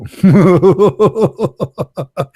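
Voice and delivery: long, high cackling laugh at his own joke; amused laugh. Real, not acted. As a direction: crack up at your own punchline.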